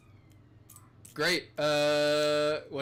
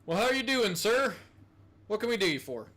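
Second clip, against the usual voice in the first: higher, cheery "how are you doing, sir"; friendly guard greeting; 6 semitones higher, faster than usual. Acted. Guard greeting a visitor at the gate, lightly voiced cheery.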